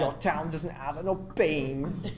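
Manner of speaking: slow, badly faked Cockney; Cockney accent. Shown as a demonstration. Another go at the sentence, botched Cockney accent. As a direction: drag out a shaky attempt at Cockney. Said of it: "It's Cockney".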